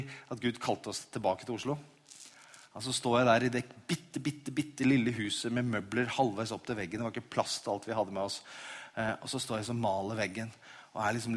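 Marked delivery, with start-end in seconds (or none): none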